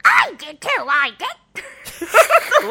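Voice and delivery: high, loud repeated cry; explosive mock-violent shout; violent outburst. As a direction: yell it over the laughter. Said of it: "That was violent".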